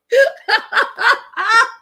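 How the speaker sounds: very high, squealing giggle; giddy laugh. Real, not acted. Still thrilled by the menu, high giggle. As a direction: giggle with glee.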